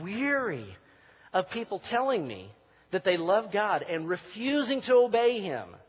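fiery preaching. Acted. Pastor rebuking churchgoers who won't obey, loud and fervent. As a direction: thunder it at the congregation.

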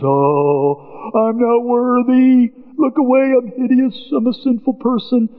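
high, slow mock-groveling Peter; high whiny mock lament. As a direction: ham up Peter's shame. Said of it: "comes to Jesus and goes".

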